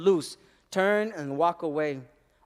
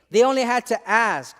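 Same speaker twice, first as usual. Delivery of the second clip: ordinary delivery, nothing marked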